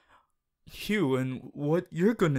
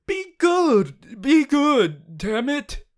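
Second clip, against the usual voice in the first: much higher, loud exasperated cry; rant; 10 semitones higher, 8 dB louder, much slower than usual. Acted. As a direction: plead and scold at once, losing it. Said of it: "rant over".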